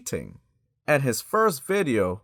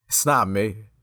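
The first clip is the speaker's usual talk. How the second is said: normal talking voice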